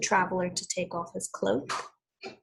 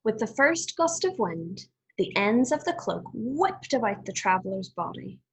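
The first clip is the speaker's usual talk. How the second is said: put-on clear, measured read-aloud for children; storybook reading voice; story narration. A narrator's reading. Teacher reads fable aloud.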